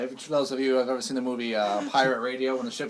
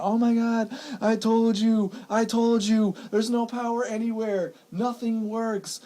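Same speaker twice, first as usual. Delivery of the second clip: imitating mom, high panicked voice; mock panic; 10 semitones higher, slower than usual. Son imitating his panicking mother, high mock panic. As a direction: play the frantic mother, pitched way up. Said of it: "she just started freaking out".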